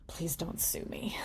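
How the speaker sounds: breathy joking whisper. Pretend. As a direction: whisper it like a secret. Mock plea after scare joke.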